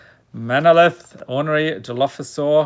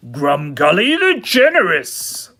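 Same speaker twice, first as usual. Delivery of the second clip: big hammy creature-name announcement, louder; put-on, over-the-top goblin name call; said properly, theatrical; 3 semitones higher, 5 dB louder than usual. Shown as a demonstration. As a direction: proclaim the name with theatrical relish. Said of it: "I gotta say it properly".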